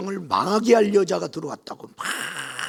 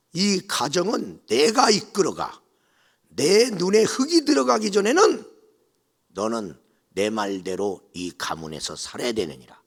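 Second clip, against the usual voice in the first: stern decree; 8 dB louder than usual. Retold or imitated. Mother-in-law declaring she rules the house, sternly. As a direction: lay down the law.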